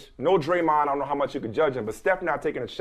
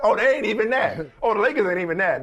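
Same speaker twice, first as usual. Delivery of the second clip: higher, talking through laughter; laughing; 6 semitones higher than usual. Real, not acted. Host defending the Lakers from the joke, laughing. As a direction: protest while still cracking up.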